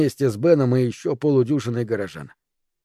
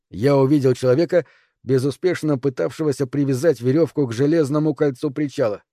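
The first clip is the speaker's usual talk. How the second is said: plain reading voice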